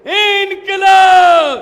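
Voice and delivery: long, loud drawn-out rally cry; slogan shout. Real, not acted. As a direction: stretch and shout it to the crowd. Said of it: "दो नारे लगा के बता दो". (ज़ोर से खींचकर नारा)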